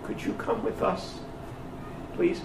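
timid guards asking ever so politely; soft, halting request; very gentle. Retold or imitated. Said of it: "they very gently say".

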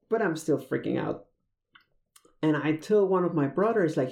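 usual speaking voice

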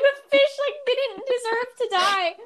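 high, slow, tearful whine; mock upset wail. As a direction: replay the dream's anguish, half-joking. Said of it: "I was like".

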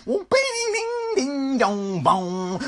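high squealing guitar notes dropping down; imitated guitar solo. The solo is building, imitated squealing guitar. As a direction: wail out a mouth-guitar lick. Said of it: "and that solo's just".

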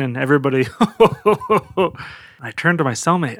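ordinary talk

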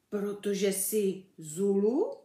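slow, puzzled guess rising at the end; drawn-out baffled question; uncomprehending. A narrator's reading. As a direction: guess hesitantly, not getting it. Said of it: "nechápal Ferda".

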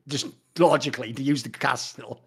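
usual speaking voice

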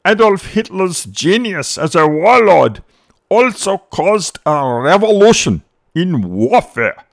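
pompous proclamation. Retold or imitated. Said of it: "He said".

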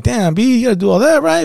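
reenacting shock at a friend's overreaction; incredulous surprise. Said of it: "And I'm like".

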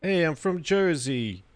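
lightly voiced New Jersey singer; Jersey accent. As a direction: fumble a New Jersey accent while singing. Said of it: "can't even think of a Jersey accent".

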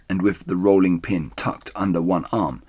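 plain reading voice

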